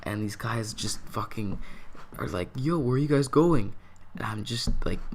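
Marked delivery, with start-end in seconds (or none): 2.6-3.7 calling out. Retold or imitated. Friends pulling up, calling out.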